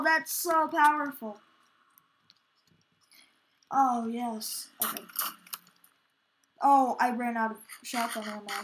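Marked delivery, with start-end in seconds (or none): none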